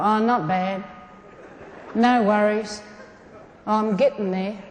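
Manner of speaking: low, level, resigned mumble of small talk; pessimistic reply. Acted. Mimicking pessimistic Australians answering how are you, downbeat. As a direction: answer with no enthusiasm at all.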